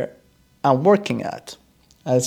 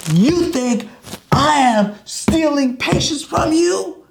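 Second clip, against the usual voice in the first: much higher and louder, slow indignant outburst; furious accusation; 12 semitones higher, 3 dB louder, slower than usual. Retold or imitated. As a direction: hurl the accusation. Doctor pounding desk accusing.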